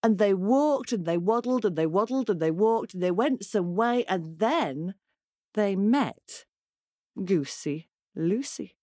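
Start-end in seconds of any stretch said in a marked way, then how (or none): none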